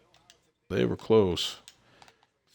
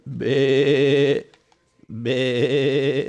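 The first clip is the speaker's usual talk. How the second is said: higher, drawn-out imitated goat bleats; 4 semitones higher, 3 dB louder than usual. Player jokes about the GOAT, imitating a goat bleat. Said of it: "that the goat".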